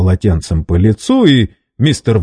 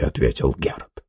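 ordinary delivery

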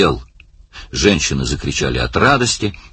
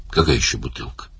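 puzzled; 5 semitones lower, 5 dB quieter, faster than usual. A narrator's reading. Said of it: "отразилось искреннее недоумение".

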